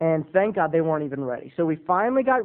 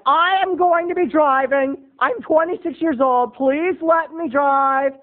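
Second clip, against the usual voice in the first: high, slow, louder pleading; pleading son; 9 semitones higher, 5 dB louder, slower than usual. Retold or imitated. Son begging his parents, high slow plea. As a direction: beg to be allowed to drive. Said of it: "I was like".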